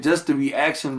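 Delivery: normal talking voice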